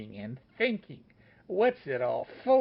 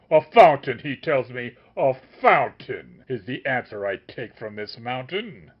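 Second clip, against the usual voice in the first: loud, contemptuous scolding; tongue-lashing; 8 dB louder than usual. A narrator's reading. The master keeps scolding the wizard, loud. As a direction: lash him with scorn, loud. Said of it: "increasing the tongue-lashing pace".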